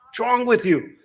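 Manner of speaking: sharp high, loud shout, then it drops; father yelling at screaming kids over puzzles. Retold or imitated. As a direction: snap at noisy kids. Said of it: "I yelled at 'em".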